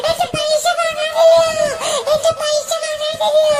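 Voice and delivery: high, slow mock wail; fake bawling. Malu pretends the money is missing, high theatrical wailing. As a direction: drag the words out through hammed-up sobs. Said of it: "ഇവളെന്തിനാ കിടന്ന് മോങ്ങണേ".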